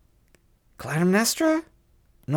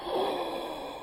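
startled gasp; 11 dB quieter than usual. Retold or imitated. Retelling a scary movie scene.

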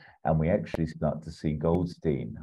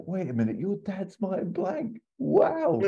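much higher, climbing to a squeal of amazement; impressed surprise. Retold or imitated. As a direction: gush with starstruck surprise. Goldstein impressed meeting Martin.

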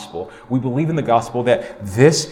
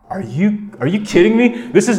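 amazed disbelief; 7 semitones higher than usual. Real, not acted. Preacher marvelling to the church, high amazed disbelief. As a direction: marvel at it, pitch leaping up.

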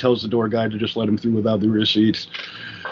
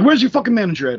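higher, louder angry demand; 7 semitones higher, 3 dB louder, faster than usual. Retold or imitated. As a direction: demand the manager, fuming. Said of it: "He's like".

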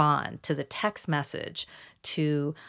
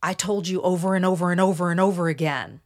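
emphatic; 4 dB louder, faster than usual. Retold or imitated. Narcissist insisting so you believe the lie, emphatic. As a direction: hammer each repeat, mimicking someone insisting. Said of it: "Narcissists use a lot of emphatic communication".

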